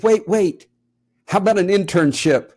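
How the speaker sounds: put-on a child rushing to stop him leaving; eager call-back. Daughter calls him back.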